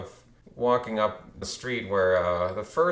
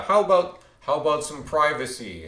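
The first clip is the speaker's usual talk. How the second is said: imitating an irritated man, higher and louder; annoyed retort; 6 semitones higher, 5 dB louder than usual. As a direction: snap it like a bothered homeowner. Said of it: "he seemed annoyed".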